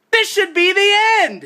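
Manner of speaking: high, exasperated shout; angry yell. Real, not acted. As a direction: shout it in outrage, still in control. Outraged at post-credit scene.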